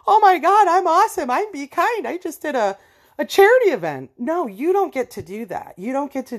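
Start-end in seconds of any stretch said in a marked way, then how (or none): 0.1-4.1 much higher, louder, preening self-praise; smug hypocrite. Retold or imitated. Cruel people praising themselves after mocking others, preening mockery. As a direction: gush about yourself, high and loud. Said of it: "then ride around and go".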